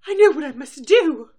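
high, loud heroic declaration; dramatic resolve. Acted. Rarity resolves in recap.

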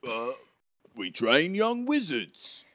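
slightly affronted storybook helper; Hagrid. Acted. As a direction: explain with surprise in a put-on accent.

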